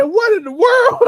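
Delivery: high, loud stunned cry. Retold or imitated. Vlogger hearing a wild claim in an ad, high stunned cry. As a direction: cry it out in total disbelief. Retold shocked reaction to ad.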